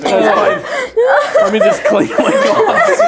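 high and slow, still giggling; after laughing hard. Real, not acted. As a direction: catch your breath after laughing. Recovering from laughter.

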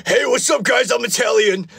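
high, badly faked Italian accent. Answering viewer's accent request, badly faked Italian accent. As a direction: pitch it up in a silly Italian accent. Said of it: "Can you do an Italian accent?".

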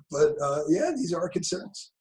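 normal talking voice

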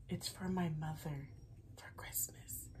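secret whisper. Real, not acted. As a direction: hush it like a secret. Secret gift, mother might hear.

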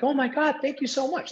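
high-pitched, gushing gratitude; thrilled teenagers. Retold or imitated. Kids thanking shop owner for free frozen yogurt, thrilled. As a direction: squeal it like excited high school kids.

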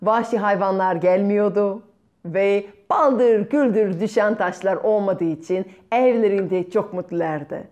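ordinary delivery, nothing marked

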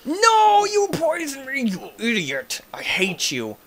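starts as a high, loud scream, coming down; outraged screaming. Real, not acted. Gamer yelling at the spider that poisoned them, outraged scream. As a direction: scream abuse at the attacker, easing off.

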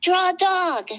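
put-on high, loud kid shouting; child's shout. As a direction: yell it out like an eager child.

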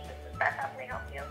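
usual speaking voice

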